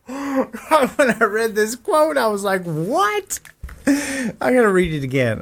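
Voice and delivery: high-pitched, slow, stunned reading; can't believe what he just read; surprised disbelief. Real, not acted. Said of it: "this is insane".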